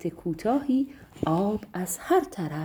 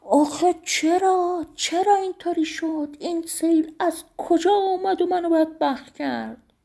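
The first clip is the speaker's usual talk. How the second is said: very high, loud, the first words slowed; anguished shout; 11 semitones higher, 6 dB louder than usual. A narrator's reading. Master Rajab Khan shouting at the flood that ruined him, high and loud. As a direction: rage at fate, wailing. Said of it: "فریاد زد".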